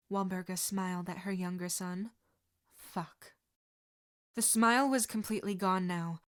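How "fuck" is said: usual speaking voice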